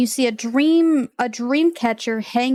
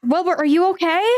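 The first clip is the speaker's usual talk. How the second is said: worried call through door; 6 semitones higher, faster than usual. Acted. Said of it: "Rose kinda knocks at the door".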